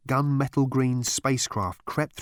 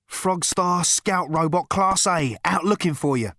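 stranger voice; 7 semitones higher, 4 dB louder than usual. A narrator's reading. Man voiced in story.

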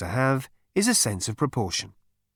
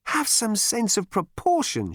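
nagging wife; 11 semitones higher, slower than usual. A narrator's reading. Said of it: "she would say".

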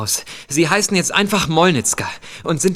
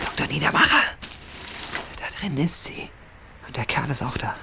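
hushed; 2 semitones lower, 8 dB quieter than usual. Acted. Sneaking up on the kidnapper.

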